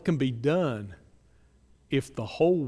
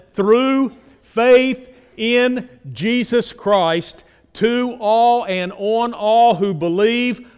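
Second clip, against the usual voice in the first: slow, loud, raised and drawn out; emphasized, underlined; 9 semitones higher, 12 dB louder, slower than usual. Shown as a demonstration. Preacher underlining faith in Jesus Christ for the congregation, slow and loud. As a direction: lean on every word of "through faith in Jesus Christ". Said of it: "and I say underline it".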